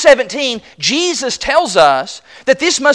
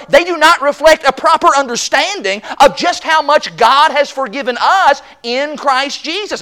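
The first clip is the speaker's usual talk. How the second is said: normal talking voice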